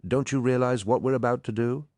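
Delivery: high, urgent appeal; agitated outburst. A narrator's reading. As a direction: plead for them to understand, pitched well up. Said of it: "unable to contain himself any longer".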